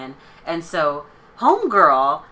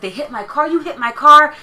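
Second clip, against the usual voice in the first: higher, louder accusation, imitated; retelling the woman yelling you hit my car; mock accusing yell; 9 semitones higher, 9 dB louder than usual. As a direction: ham up the screaming accusation. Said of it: "she's like".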